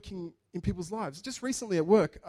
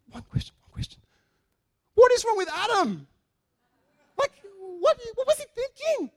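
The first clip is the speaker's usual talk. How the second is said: much higher, louder Indian accent impression; 6 dB louder than usual. Preacher voicing the coworker's question about Adam, Indian accent. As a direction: squeal it out in a pronounced Indian accent. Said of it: "With a very pronounced Indian accent".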